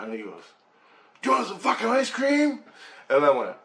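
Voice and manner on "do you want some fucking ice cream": high-pitched mock shout; hammed-up angry ice-cream man; imitated angry yell. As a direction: yell the offer like a furious threat. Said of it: "he yelled at me".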